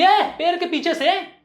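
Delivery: high, slow hail at someone hiding; acted challenge from a vendor; accusing call-out. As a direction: call him out from behind the tree. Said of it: "ये भी रवि को बोलने लगी".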